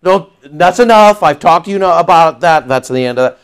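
loud, exasperated ruler cutting off a petitioner; fed-up earthly king. Acted. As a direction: act out a king who has heard enough. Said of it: "like an earthly demagogue, like an earthly king".

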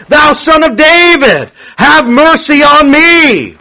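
high, impassioned preacher's cry. Acted. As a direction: cry the plea out high over the crowd. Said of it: "he cried the more a great deal".

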